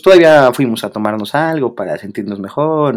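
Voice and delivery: usual speaking voice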